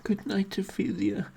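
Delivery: breathy strained whisper mid-stretch. Real, not acted. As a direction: push the words out while stretching. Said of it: "trying to talk while stretching".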